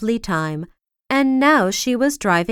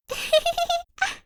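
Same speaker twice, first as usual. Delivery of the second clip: put-on light, very high girlish giggle; a bright tinkling giggle; 5 dB quieter than usual. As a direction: Sunshine giggling at the king. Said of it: "Little Miss Sunshine giggled".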